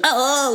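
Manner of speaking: very high, strangled cry; a retold scream done high and short; mock terrible shriek. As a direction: imitate her awful dying shriek. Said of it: "lets out, like, such a terrible noise".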